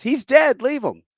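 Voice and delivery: high, loud acted-out objection; alarmed protest. Young kid protests as Bill goes for the body, alarmed. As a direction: cry it out as a frantic kid.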